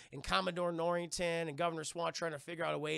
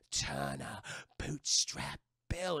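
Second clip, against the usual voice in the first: much lower, slow spooky voice; ominous ghost murmur; 3 semitones lower, much slower than usual. Retold or imitated. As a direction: murmur the name like a haunted crew. Pirates murmur the name.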